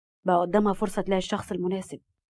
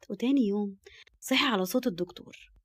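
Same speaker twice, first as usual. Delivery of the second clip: usual speaking voice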